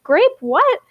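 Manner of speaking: high-pitched baffled question; startled. A narrator's reading. Pager falls over on seeing Grape, startled. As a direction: blurt it in disbelief.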